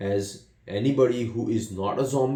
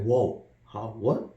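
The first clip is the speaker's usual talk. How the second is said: shocked; 5 semitones higher than usual. Retold or imitated.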